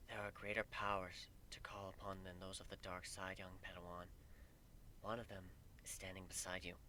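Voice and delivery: a grave goddess murmuring a prophecy of doom; hushed solemn goddess. Acted. Goddess warning Griffin his hate will kill him, hushed and grave. Goddess quoting Star Wars softly.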